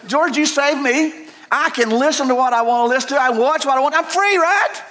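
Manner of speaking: high, put-on whiny voice mocking himself; high mocking falsetto. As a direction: play the self-justifying complainer. Mocking a self-justifying voice.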